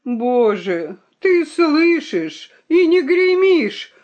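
slow plea climbing to a wail; despairing appeal. A narrator's reading. The mouse calls on God to thunder, despairing. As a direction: implore heaven, drawing out the words. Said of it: "заломил лапки мышебрат".

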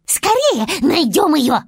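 high, loud urgent chipmunk voice. Acted. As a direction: urge everyone on like excited chipmunks. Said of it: "сказали бурундуки".

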